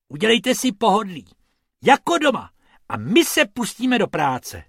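high, loud and fast friendly welcome; eager rabbit. Acted. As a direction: rush the tourists into comfort. Rabbit greeting tourists.

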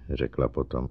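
plain reading voice

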